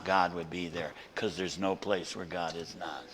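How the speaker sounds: soft, low "God, would be there"; hushed. Acted. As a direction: drop it to a near hush. Quiet version before loud climax.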